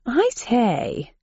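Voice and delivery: clipped tea-time Englishman; posh English gentleman. A narrator's reading. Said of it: "sounded like crumpets and tea at four o'clock sharp".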